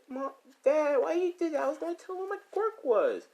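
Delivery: put-on very high-pitched kid's protest; whining child. Child protesting to mom and dad, high whiny kid. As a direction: protest to parents like a small child.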